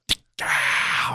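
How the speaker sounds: a soft, breathy "tick-ow" like a far-off rifle crack; imitated rifle shot. Hunter mimics the far-off shots he heard at dawn, soft breathy gunshot sound. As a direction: mouth the sound of a distant gunshot. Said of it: "I went".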